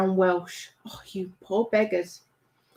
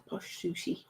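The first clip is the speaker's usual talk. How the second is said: whispered aside; 5 semitones lower, 16 dB quieter than usual. Real, not acted. Teasing aside about Lewis.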